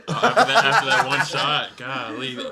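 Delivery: giggling through the words; laughing while talking. Real, not acted. Laughing after a bad shot.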